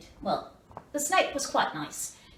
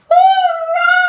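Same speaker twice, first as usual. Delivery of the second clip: very high, loud triumphant shout; joyful cheer; 14 dB louder than usual. A narrator's reading. The freed baby elephant cheering, loud high hurrah. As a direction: cheer with total relief.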